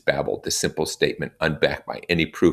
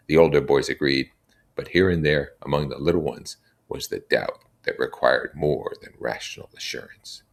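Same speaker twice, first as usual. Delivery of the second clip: ordinary delivery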